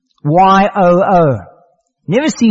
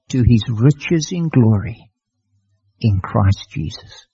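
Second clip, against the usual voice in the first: reverent closing verse; 5 semitones lower, slower than usual. Acted. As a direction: let the promise settle gently.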